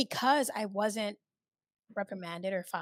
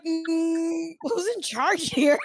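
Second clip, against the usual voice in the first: high, loud, drawn-out question with laughter; mock security guard; 7 semitones higher, 8 dB louder, much slower than usual. Security guards questioning the bossy worker, laughing mock challenge. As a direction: stretch it out in playful challenge, breaking into laughs.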